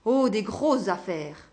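slow, gruff and dismissive. A narrator's reading. Businessman brushing off his nosy neighbour, slow and gruff. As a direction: brush him off gruffly, slowly. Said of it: "d'un ton bourru".

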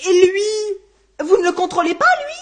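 loud, high indignant complaint; outraged protest. Acted. Man to police who skip his neighbour, outraged. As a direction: protest loudly at the unfairness. Protests the other isn't checked.

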